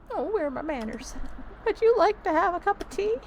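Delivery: high, polite granny voice; put-on old-lady politeness; hospitable old lady. Old tenant offers tea.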